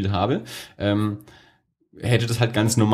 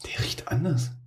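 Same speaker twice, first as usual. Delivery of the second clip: whispering; 5 semitones higher, 6 dB quieter than usual. Real, not acted. Whispered remark about the wine.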